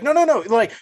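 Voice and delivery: high, loud, panicky protest; hammed-up frightened whine; mock panic. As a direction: imitate a scared streamer refusing to go upstairs. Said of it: "And he's like".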